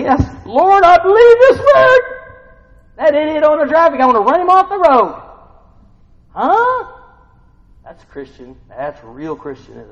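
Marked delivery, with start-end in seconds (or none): 0.0-2.0 high, loud parody of a pious believer; mock pious churchgoer. Preacher mocking a churchgoer who says yes, Lord. 3.0-6.9 high, loud mocking yell; full-force preaching shout. Real, not acted. Preacher yelling to the congregation about road rage.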